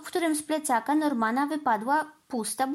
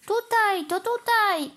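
high, raised-voice shout for attention; calling out; 10 semitones higher, 4 dB louder, slower than usual. A narrator's reading. Norman and Mandy hailing Tom's helicopter at the fire, calling out. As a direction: cry out, pitch jumping well up.

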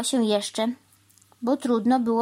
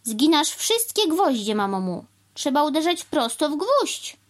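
scolding; 7 semitones higher than usual. A narrator's reading. Crow telling the cow she bends every nail, scolding. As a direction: scold and instruct, pitched high. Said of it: "zawołał pan Wrona".

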